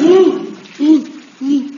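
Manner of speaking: high loud ooh of shock; shocked squeals. Acted. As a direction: squeal as cold water hits. Drenched by water from tarpaulin.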